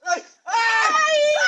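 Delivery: loud, high shouted cry; angry cry. Acted. Woman shouts in anger at her friend, loud high cry.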